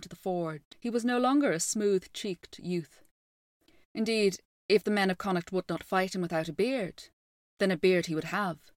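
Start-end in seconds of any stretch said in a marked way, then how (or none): none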